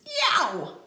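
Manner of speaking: high, sudden yelp of awe; impressed yelp. Acted. Narrator awed by the sunglasses, high yelp. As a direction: yelp at how cool it is.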